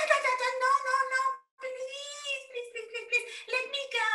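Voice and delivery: high, loud frantic pleading; desperate begging. Acted. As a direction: beg not to be eaten. Mouse begs desperately.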